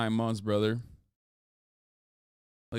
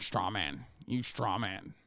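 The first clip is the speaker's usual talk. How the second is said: mocking mutter; 4 semitones lower, 8 dB quieter, slower than usual. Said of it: "it's like".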